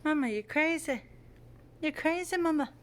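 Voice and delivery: high, playful cat voice; voicing the cat. Cat teasing his owner in a high playful voice. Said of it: "He's telling me he wants dinner".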